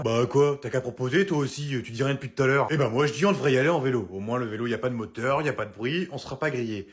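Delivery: normal talking voice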